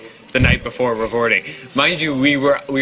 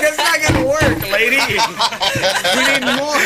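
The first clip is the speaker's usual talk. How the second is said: indignant demand; 10 semitones higher than usual. Retold or imitated. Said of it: "I'm like".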